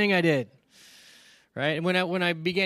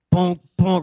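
knocking sound; 11 dB louder than usual. Retold or imitated. Holy Spirit knocks on chest.

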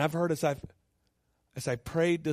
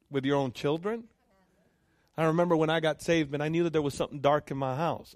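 ordinary delivery

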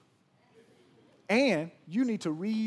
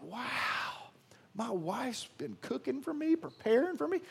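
higher, delighted mock Adam; naive Adam; 7 semitones higher than usual. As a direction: play Adam pleased with his tray. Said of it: "Adam thought".

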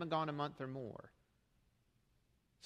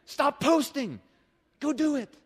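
higher and louder, each command punched; exasperated shout; 10 semitones higher, 10 dB louder, slower than usual. Acted. Pastor telling complaining posters to stop, loud exasperation. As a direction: bark the orders at them, fed up. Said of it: "that really came from a deep pent-up place".

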